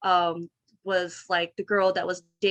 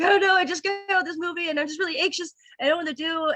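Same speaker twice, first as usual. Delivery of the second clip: anxious; 8 semitones higher, faster than usual. Retold or imitated. Anxious call to boyfriend.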